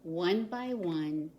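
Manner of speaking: ordinary delivery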